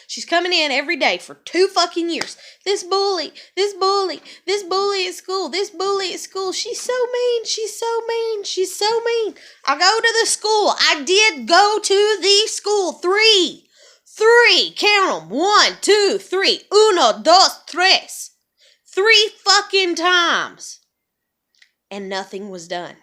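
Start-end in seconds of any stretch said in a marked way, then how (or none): 2.6-9.3 put-on much higher, whiny kid voice; imitating whining child. Voicing daughter's complaints. 9.6-20.8 loud, slow, hammered counting; mother furious the school did nothing, loud and slow; angry emphatic. Real, not acted. Angry about school inaction.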